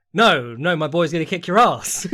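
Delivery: loud, insistent imitation of a loyal friend; mock defiant boast. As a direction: act out backing your boy, loudly. Acts out friend's defiant boast in argument.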